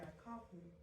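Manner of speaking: ordinary talk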